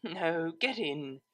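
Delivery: soft, slow, gentle command; sleepy but firm; soft insistence. A narrator's reading.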